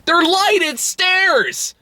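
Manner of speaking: slow, high incredulous complaint; disbelieving rant; exasperated. Real, not acted. Exasperated rant about flashlight.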